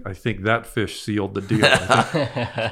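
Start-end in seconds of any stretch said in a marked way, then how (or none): 1.6-2.7 a laugh breaking in; laughing at his own joke. Real, not acted. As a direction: laugh through it. Laughing about sealing the deal.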